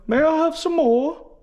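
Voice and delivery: very high pleading orphan voice; Oliver Twist. Acted. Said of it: "Oliver Twist, right? The soup kid".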